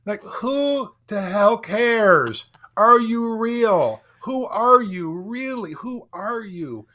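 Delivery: high, slow, loud searching questions; passionate challenge. Real, not acted. Speaker urging listeners to be real, slow and impassioned. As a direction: drag out each question, pleading for realness.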